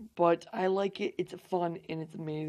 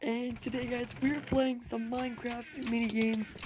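higher, louder, upbeat channel greeting; hyped YouTuber intro; 5 semitones higher, 3 dB louder than usual. Acted. Gamer greets his viewers with a hyped-up channel intro. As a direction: pump up the viewers as the video opens.

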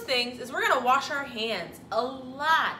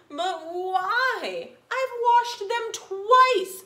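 high, loud protesting princess; cartoon princess; 8 semitones higher, 6 dB louder, much slower than usual. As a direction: whine it like a little princess. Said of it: "said the little princess".

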